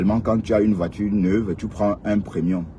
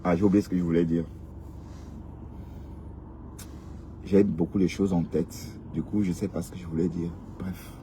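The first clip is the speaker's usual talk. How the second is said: trailing off; 5 semitones lower, 10 dB quieter than usual. Real, not acted. Forgets what she wanted to say.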